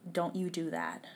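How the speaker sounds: low, soft, menacing warning; quietly threatening, like a stepdad stopping a call; low stern warning. Retold or imitated. Stepdad grabbing the phone from his stepdaughter, quiet and threatening.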